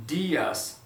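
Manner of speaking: "Dias" with the i held longer; long stressed i. Shown as a demonstration. Teacher stretches the accented i in "Dias". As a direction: lean on and lengthen the i in "Dias". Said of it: "One, one more time".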